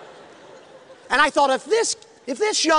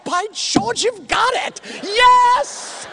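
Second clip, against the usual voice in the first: much higher, louder delight; overjoyed; 11 semitones higher, 5 dB louder, much slower than usual. Real, not acted. Preacher rejoicing over the young man, high excited cry. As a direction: burst with joy at the news.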